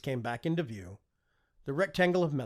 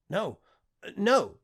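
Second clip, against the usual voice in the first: panicked denial; 8 semitones higher, 9 dB louder, slower than usual. A narrator's reading. Panicked lord shaking his head.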